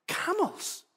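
high, incredulous echo of the word; mock surprise. Preacher echoing his son's answer in mock disbelief. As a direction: act baffled, voice leaping up. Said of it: "And I went".

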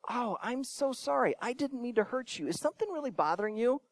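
soft, gentle, concerned voice; calm apology. Acted. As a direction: answer softly and soothingly, acting out a calm reply. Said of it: "give them a calm response".

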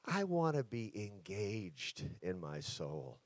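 ordinary talk

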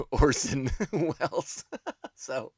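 cracking up mid-phrase; laughing. Real, not acted. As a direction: laugh through the words at your own slip. Cracks up at own word mix-up.

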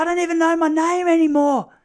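high, loud mock-panicked wail; panic attack. Stung boy asking why he's short of breath, hammed-up panic. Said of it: "You're having a panic attack".

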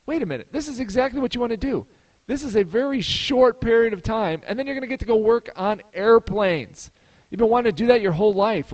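eager, high-pitched self-persuasion; retold excited realization. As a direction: talk yourself into it with rising excitement. Acts out excited self pep talk.

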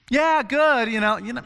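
high, loud, cheery forced answer; mock casual reply. Guest answering small talk at Jesus's dinner, high and loud mock cheer. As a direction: chirp it back brightly.

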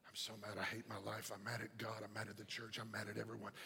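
low, barely audible grumble; very soft, low, monotone muttering; murmuring under breath. Acted. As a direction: mutter complaints to yourself under your breath. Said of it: "A murmurer is".

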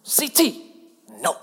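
put-on playful child scolding dad; a hint of a six-year-old saying no; child's voice. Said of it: "She'll say".

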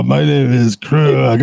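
slow, hammed-up cartoon voice; lightly voiced Strong Bad. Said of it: "You sound like Strong Bad".

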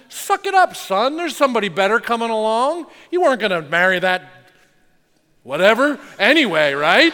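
loud, high, fast brush-off; hammed-up tough-love dad waving off the heartbreak; dismissive dad. Dad to his heartbroken teen son, loud and fast mock brush-off. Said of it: "as a dad, you know what you do".

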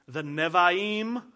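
"Nevi'im" slow and careful, ne-vee-eem, rising; Hebrew word Nevi'im. Shown as a demonstration. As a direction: spell out "Nevi'im" slowly for learners. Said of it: "These are Hebrew words".

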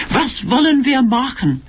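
high, swooping lover's telephone voice in German; performed, fluting sweet talk; theatrical cooing. A narrator's reading. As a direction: gush extravagantly to a darling on the phone. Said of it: "watching her like a performance at the theatre".

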